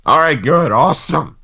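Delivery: loud, high-pitched and forceful; aggressive. Real, not acted. Co-host relieved there's time left in the show, sounding aggressive. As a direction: hit it too hard, aggressively upbeat. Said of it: "Why did you sound so aggressive?".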